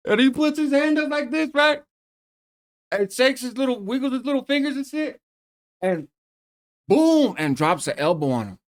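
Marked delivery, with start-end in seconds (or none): 0.1-7.4 much higher, excited storytelling; excited retelling. Real, not acted. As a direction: build up the big moment. Reliving the elbow drop.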